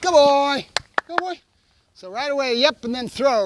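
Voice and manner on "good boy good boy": high, loud burst of praise; excited dog praise. Real, not acted. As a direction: reward the dog with your voice. Praising dog after release marker.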